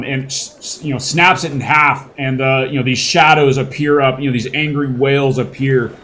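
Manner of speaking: usual speaking voice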